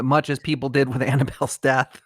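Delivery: ordinary talk, nothing marked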